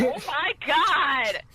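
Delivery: taken aback, slow and high; startled. Real, not acted. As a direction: stretch it out in real shock. Startled by sudden shout.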